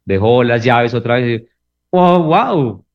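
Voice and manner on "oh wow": a high, put-on mock amazement; sarcastic wow at the wife forgetting her keys. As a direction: fake being impressed. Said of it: "No sé, sarcasmo".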